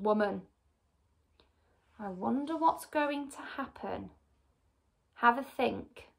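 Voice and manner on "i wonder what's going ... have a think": soft teacher aside. Real, not acted. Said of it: "teacher aside to listeners".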